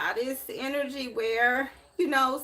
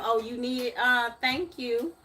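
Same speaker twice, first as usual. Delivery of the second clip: mimicking her friend; 2 semitones higher than usual. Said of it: "talking about".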